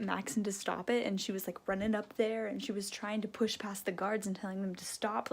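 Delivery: soft whisper, no voice; hushed retelling. Real, not acted. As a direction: rush the story under your breath.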